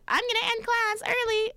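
high, mocking voice for the teacher's words; imitating music teacher. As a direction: mimic the teacher in a high, silly voice. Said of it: "He said".

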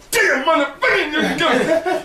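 high, slow, hammed-up curse through a laugh; mock her yelling in pain, half laughing; angry outburst. Storyteller imitates a trainee cursing in pain, hammed-up. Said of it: "she's going".

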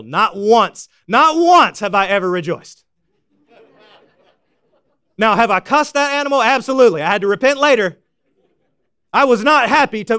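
loud, driving sermon voice; fiery preacher pushing the point; preaching at full force. Acted. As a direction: hammer it home from the pulpit.

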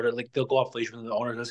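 ordinary delivery

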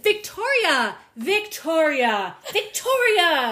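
loud high scolding call of a name; shaming scold. Retold or imitated. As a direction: call her name out like scolding a naughty dog. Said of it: "Like they're shaming".

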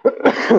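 real cough; a sharp dry cough. Real, not acted. Preacher coughing from allergies, real cough. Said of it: "Excuse me".

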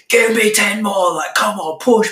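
loud, high drill-coach shouting; barking gym coach. Retold or imitated. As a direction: bark orders like a pushy coach. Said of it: "the masculine approach would be the coach that comes in".

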